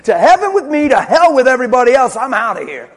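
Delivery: a hint of a smug believer, loud; mocking a believer who only wants rescue. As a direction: lightly play the selfish one leaving everyone behind. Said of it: "here's what you're saying".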